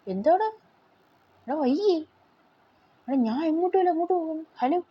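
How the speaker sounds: put-on lightly squeaky high baby voice; high playful voicing of the game baby. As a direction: pitch up to play the baby character.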